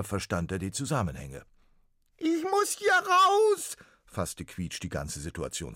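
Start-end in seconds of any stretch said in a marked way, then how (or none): 2.2-3.8 very high, loud squeaky ghost cry; pitiful little ghost. Acted. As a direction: wail it like a tiny trapped ghost. Ghost sums up.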